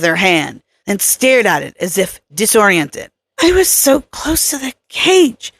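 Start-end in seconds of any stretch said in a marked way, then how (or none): 3.4-5.5 higher woman's voice, a touch of shock; Kelly, dazed. A narrator's reading. Kelly telling Troy she was near the cage, higher voice. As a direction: lift into Kelly's voice, lightly stunned.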